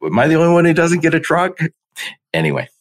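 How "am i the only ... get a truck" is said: high, fast, incredulous whine; mock disbelief. Driver surrounded by trucks, high fast disbelief. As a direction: squeal it like you can't believe the traffic. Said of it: "And you're like".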